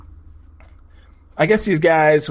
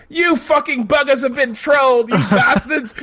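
higher-pitched British accent impression; 9 semitones higher than usual. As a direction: imitate a gloating Brit, pitched up. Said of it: "he's got this fucking British accent".

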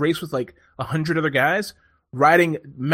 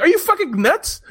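mock disbelief, much higher and louder; incredulous; 14 semitones higher, 5 dB louder than usual. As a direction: blurt it as if he's lost his mind. Speaker's retold disbelief.

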